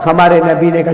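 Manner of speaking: ordinary delivery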